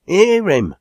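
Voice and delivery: very high, loud cheer; crying out. A narrator's reading. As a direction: hail the speaker's idea with a shout. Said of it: "cried Enoch".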